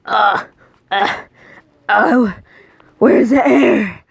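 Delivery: hammed-up can't-breathe panic; mock panic gasping. Choking in Granny's smelly bathroom, hammed-up panic.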